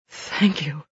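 understated choked gratitude; choked and low. Acted. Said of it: "his voice choked and low".

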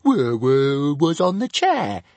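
high dragon voice, sniffy; the dragon sniffing an excuse. A narrator's reading. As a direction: make an excuse, offended. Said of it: "sniffed Toothless".

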